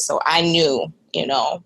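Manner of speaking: usual speaking voice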